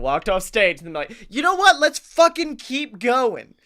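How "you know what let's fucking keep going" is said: high, loud mock hype; rousing comeback. Speaker acts returning to the stage, hyped-up shout. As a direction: burst back in, drawing out the last words.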